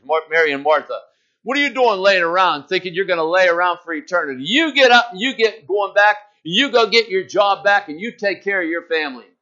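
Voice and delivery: preaching. Acted. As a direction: preach it out to the congregation. Preacher retelling Lazarus story.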